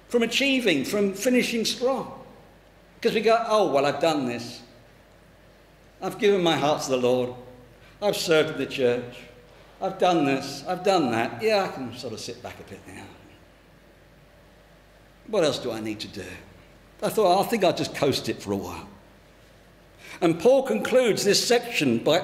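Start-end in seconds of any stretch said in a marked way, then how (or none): 3.5-16.3 lower, offhand mock excuses; complacent. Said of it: "That's what complacency is". 17.4-18.9 low, quick, offhand mock decision; lightly acted laziness; complacent. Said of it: "That's what complacency is".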